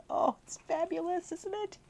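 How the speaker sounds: high gushing delight; gushing aside. Real, not acted. Reader gushing to listeners, high and delighted. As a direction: squeal over the story.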